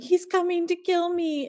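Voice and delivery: high-pitched imitation of a panicking kid; mock meltdown. Kid in the psychiatric hospital pleading for his life, mock panic. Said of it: "like, melts down on the door".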